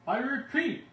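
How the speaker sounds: very high, loud cry; high shouting Lorax. A narrator's reading. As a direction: cry it out in desperation. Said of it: "cried the Lorax".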